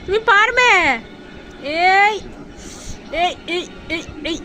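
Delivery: urging her pet to grab the puffed rice, high and coaxing; coaxing pet talk. Acted. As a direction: cheer the little one on like a baby. Cheering pet on.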